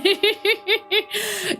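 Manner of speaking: high giggling burst; high laugh. Real, not acted. Streamer giggling at her own joke, high laugh.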